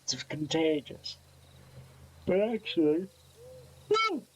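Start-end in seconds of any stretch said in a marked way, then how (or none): 3.9-4.2 a high squeaky yawn that turns into a woo. Real, not acted. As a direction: let a big yawn break out as a high woo. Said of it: "Now I'm yawning again".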